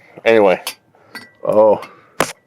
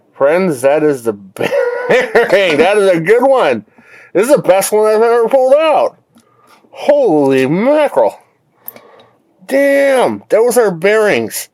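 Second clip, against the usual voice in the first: gleeful disbelief at the find; excited; 10 semitones higher than usual. Real, not acted. Thrilled by melted bearings.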